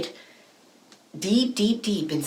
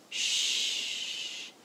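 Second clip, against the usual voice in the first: shush; 7 dB quieter than usual. A narrator's reading. Hushing listeners.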